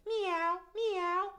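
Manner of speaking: high, kittenish meowing; cat meow. Shown as a demonstration. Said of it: "what sound does the cat make?".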